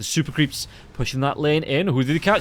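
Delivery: rising excited cast call; play-by-play. Acted. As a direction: call the fight, building up.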